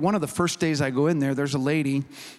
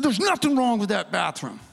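higher, mock-combative retort; defensive protest; 5 semitones higher than usual. New guy defending the bathroom he cleaned, defensive. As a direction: imitate a worker bristling at criticism.

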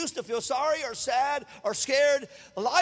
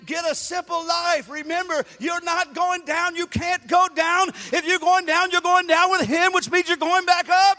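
full-force preaching; preaching climax; 7 semitones higher, 10 dB louder than usual. Acted. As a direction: drive it home to the crowd.

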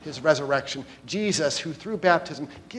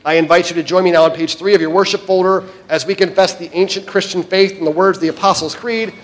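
ordinary talk, nothing marked

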